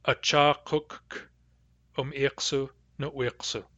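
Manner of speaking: Acha kuk um iksu no iksu slowed, kuk held; slow model phrase. Shown as a demonstration. Teacher slowing the phrase with stacked Ks. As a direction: say Acha kuk slowly, doubled K. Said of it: "In Hadassa dialect, this is".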